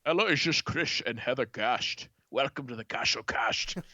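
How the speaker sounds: Scottish star phoning in a show welcome; Sean Connery. Acted. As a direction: announce it in a slushy mock-Scottish voice. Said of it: "I'll call in as Sean Connery".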